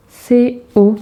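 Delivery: «C O», chaque lettre bien détachée; épelez «C O» lentement, comme en classe; lettres épelées. Shown as a demonstration. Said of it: "le son que". (épeler «C O» pour les élèves)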